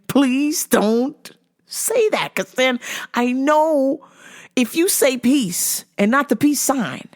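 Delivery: mock-desperate, drawn-out plea; rising plea. Pleading grows more urgent.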